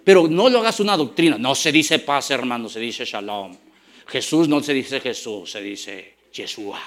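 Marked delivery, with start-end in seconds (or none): 1.4-6.8 imitando a un creyente pedante, más grave y suave; burla de beato. Retold or imitated. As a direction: remeda con tono de corrección piadosa, bajando la voz. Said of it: "se creen que saben hebreo". (burla ligera de un hermano que corrige, más grave y suave)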